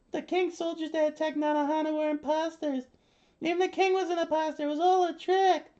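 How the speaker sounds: put-on very high-pitched pleading kid voice; beaten kid. A narrator's reading. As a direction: squeal it out as an upset child. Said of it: "The kid said".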